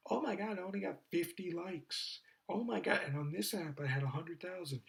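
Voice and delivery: high mock outrage of a girl. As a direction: whine like an upset influencer. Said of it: "girls are pissed off where they're like".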